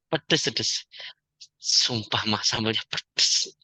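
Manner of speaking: a mildly voiced nagging wife, slow; a hint of a complaining wife, drawn out; imitating the wife. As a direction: lightly play the wife grumbling to her husband. Wife voiced complaining about sambal.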